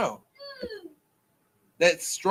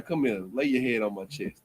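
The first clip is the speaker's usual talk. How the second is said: soothing hush; 7 semitones lower, 6 dB quieter than usual. Pretend. Comforting the crying woman.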